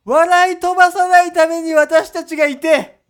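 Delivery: loud campaign speech voice; rally speech. Pretend. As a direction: orate like a candidate on a street corner. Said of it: "日本激気症党だ。".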